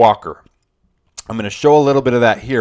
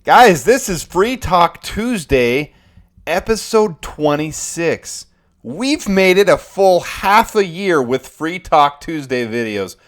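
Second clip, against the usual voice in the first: slow, higher, louder show opener; hyped intro; 6 semitones higher, 4 dB louder, slower than usual. Acted. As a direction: announce it like a host.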